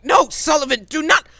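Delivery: high, loud urgent shout; panicked NPC calling out a warning. Acted. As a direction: yell to stop him from going.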